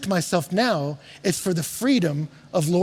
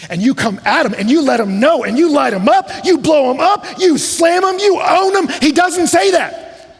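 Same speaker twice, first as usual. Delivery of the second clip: building preacher rant; 9 semitones higher, 7 dB louder than usual. Acted. Preacher mocks social media pile-ons with a loud building rant. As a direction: pile it on, louder each time.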